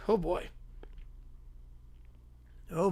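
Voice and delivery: normal talking voice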